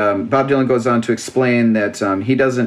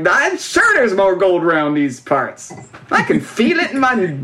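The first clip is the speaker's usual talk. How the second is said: imitating a grizzled gold prospector; old prospector; 6 semitones higher than usual. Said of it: "Chaz is like".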